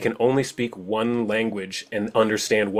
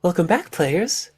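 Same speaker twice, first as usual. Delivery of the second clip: Baba Yaga voice; 5 semitones higher than usual. Acted. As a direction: greet them like a looming witch. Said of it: "She looks down to you and says".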